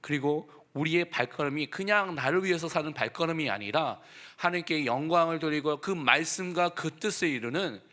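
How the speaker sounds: ordinary talk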